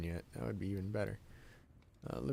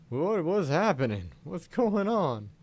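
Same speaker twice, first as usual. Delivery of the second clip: confused surprise; 7 semitones higher, 7 dB louder, slower than usual. Retold or imitated. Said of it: "He finally turns around like".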